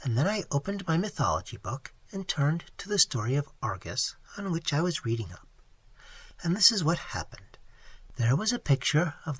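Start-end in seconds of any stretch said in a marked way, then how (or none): none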